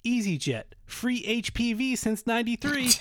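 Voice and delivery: high-pitched commercial tagline; mock ad slogan. Reading joke airline slogans to co-host, mock ad read. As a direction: pitch it like a peppy advert. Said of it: "EasyJet slogans".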